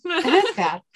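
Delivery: high, loud laughing aside; cracking up mid-sentence. Real, not acted. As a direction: say it through a burst of laughter. Co-hosts crack up during reading.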